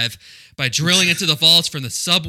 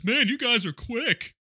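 high, slow dopey voice; dumb detective; 11 semitones higher, slower than usual. Retold or imitated. Mocking dumb Detective Ricky, high, slow and dopey. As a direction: play him high and slow like a clueless cop. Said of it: "dummy Detective Ricky says".

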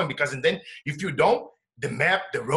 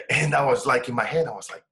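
normal talking voice

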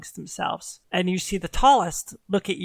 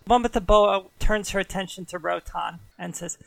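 ordinary talk

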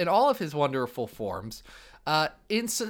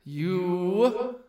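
Dracula voice; 3 dB quieter than usual. Acted. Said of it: "I am Dracula".

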